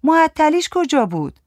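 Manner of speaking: high, whiny protest; grumbling. A narrator's reading. Giti objects to her friend in the taxi, grumbling. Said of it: "گیتی نق زد".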